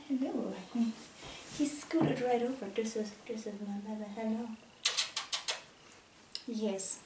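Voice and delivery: hushed, fast cooing to the pup; soft dog talk. Real, not acted. Owner snuggling her dog, soft hushed cooing. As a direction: murmur sweet nothings to the dog.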